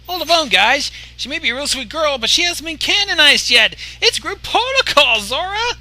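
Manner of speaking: high, loud, excited reminder; hyperactive shout. Acted. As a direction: burst in with hyper energy. Tomo's hyperactive outburst.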